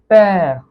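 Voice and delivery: "पैर" said as French père, with an open è and a little extra weight; French pronunciation demo. Shown as a demonstration. Teacher answering her own question with the French word, careful pronunciation. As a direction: model "पैर" with the open è held clearly. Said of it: "क्या pronunciation होगा".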